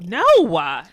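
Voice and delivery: "Noah" loud as NO-wah; drawn-out "no-ah". Shown as a demonstration. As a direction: punch "Noah" as two loud syllables. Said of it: "I say Noah too, though".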